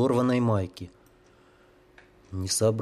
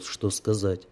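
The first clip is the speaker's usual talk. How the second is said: plain reading voice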